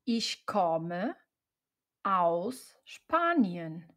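ordinary delivery

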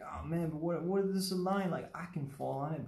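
high-pitched, wavering-minded learner questioning the rule; tempted student. Acted. Art teacher voicing a student tempted by a stray line, mock pleading. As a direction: act out someone talking themselves into a shortcut. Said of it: "you'll be like".

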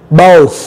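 "both" ending in pure breath on TH; voiceless TH. Shown as a demonstration. Teacher showing air only, voiceless TH. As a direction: finish "both" with an unvoiced TH. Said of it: "cái hơi đó, chỉ đưa nó ra thôi".

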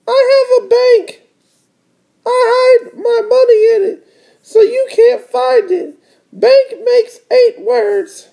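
very high, boastful little-sister voice. Acted. Little sister bragging to her brother, very high voice. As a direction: brag in a squeaky cartoon kid voice. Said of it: "said D W".

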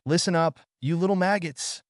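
a high drill sergeant bark over the speaker; loudspeaker roar. A narrator's reading. Chef roaring at the campers through the loudspeaker. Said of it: "Chef's voice roared out of the loudspeaker".

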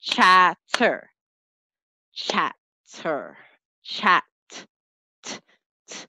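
slow, drawn-out chatter split into beats; clapping out syllables. Shown as a demonstration. Teacher clapping syllables for children, slow and drawn out. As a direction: stretch chatter out beat by beat. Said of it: "we can still clap it out".

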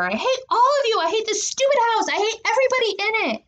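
very high, escalating fury; screaming tantrum. A narrator's reading. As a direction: rage at the whole family. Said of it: "I screamed to her".

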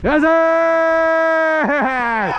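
high, long-held cry of triumph; excited yell. Real, not acted. Fielder to teammates after the ball, excited high yell. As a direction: stretch it out high across the field.

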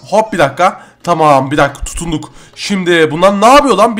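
ordinary talk, nothing marked

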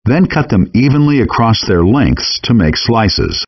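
ordinary talk

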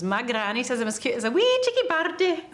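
put-on light Glaswegian accent, child's line; Glasgow accent. Shown as a demonstration. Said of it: "Can you do the Glaswegian accent?".

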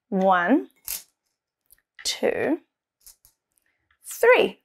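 put-on slow counting aloud for kids; teaching a child to count. Shown as a demonstration. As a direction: count each one out with pauses. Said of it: "the three green M and M's".

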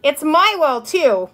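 loud, high indignant sister; tattletale cry. A narrator's reading. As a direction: cry out your complaint to mother. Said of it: "cried Tattletale Beezus".

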